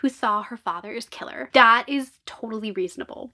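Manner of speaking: usual speaking voice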